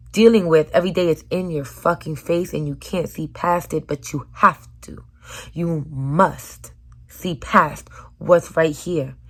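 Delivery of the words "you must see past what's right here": very slow emphasis; slow urging. Real, not acted. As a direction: drag every word. Urging slowly.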